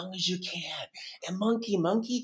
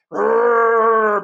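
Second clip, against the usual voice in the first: loud, long playful roar; big held growly roar; bear roar; 3 semitones higher, 11 dB louder than usual. Pretend. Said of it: "let's move to bear exercises".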